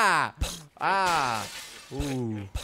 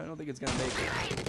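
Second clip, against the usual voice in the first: usual speaking voice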